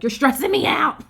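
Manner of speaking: mock loud whine of a grown son; exasperated son. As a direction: push back at a fussing mom. Son protesting to his mother.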